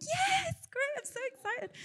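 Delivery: falsely bright reply to a friend's question; fake enthusiasm. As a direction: pretend to be thrilled when you're not. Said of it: "But really, I was like".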